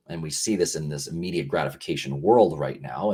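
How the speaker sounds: ordinary talk, nothing marked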